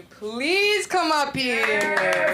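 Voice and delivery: loud, slow, stretched-out summons; host's stage call. Real, not acted. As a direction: call the next act up, drawing it out. Host calls the next act up.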